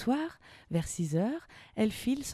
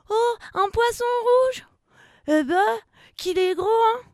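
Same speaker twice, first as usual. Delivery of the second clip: put-on high, loud childlike wonder; amazed exclamation; 9 dB louder than usual. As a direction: marvel at what's outside the porthole. Character marvels at fish through porthole. (émerveillement aigu et bruyant)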